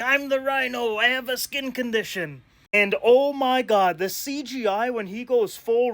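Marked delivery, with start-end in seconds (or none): 0.0-2.4 lightly mocking cartoon-villain voice, a little higher; a hint of a silly Saturday-morning villain; goofy Rhino impression. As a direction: play him as a dim, goofy cartoon brute. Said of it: "he's like".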